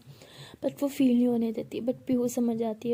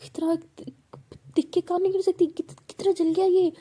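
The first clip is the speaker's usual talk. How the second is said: higher and louder, words breaking with worry; tearful panic; 7 semitones higher, 5 dB louder than usual. Acted. Pihu crying over Bhavna's burnt hand, tearful and loud. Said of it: "Pihu भी रोने लग जाती है".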